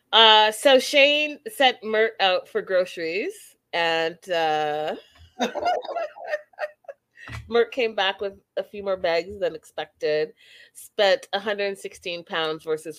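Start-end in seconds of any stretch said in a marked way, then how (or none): 5.4-6.7 shrieking burst of laughter; high squealing laugh. Real, not acted. Laughs describing groceries.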